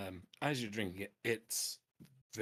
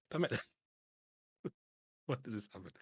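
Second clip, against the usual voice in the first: ordinary talk, nothing marked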